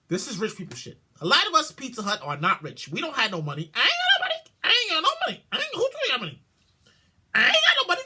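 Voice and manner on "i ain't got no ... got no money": mock broke whine. Mock pleading about money.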